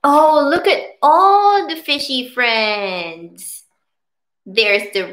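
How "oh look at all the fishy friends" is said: high delighted gasp and cry; wide-eyed storytime wonder; excited. Real, not acted. Excited reveal to child.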